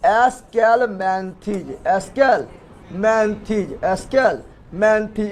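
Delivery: Aschelminthes stretched out, part by part; slow dictation of Aschelminthes, pausing between parts; slow syllable drill. Shown as a demonstration. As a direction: sound out Aschelminthes very slowly for pupils. Drilling the phylum name slowly.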